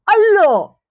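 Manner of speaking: loud, high "¡Hala!" of disbelief; astonished shout. Retold or imitated. The tattooist reacting to her first tattoo, shouting in disbelief. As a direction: blurt it out amazed.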